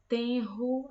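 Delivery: tenro with a throaty R after the n; strong R. Shown as a demonstration. Teacher giving tenro as the example, clearly. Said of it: "o R vai ter o som forte".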